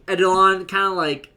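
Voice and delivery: ordinary delivery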